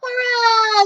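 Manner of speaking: high, loud cheering; cheering crowd. Acted. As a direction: cheer like the whole village. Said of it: "they yelled".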